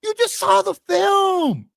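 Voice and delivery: high, slow, hyped-up repeat. Real, not acted. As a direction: stretch it out, way up high. Repeats it, hyped even more.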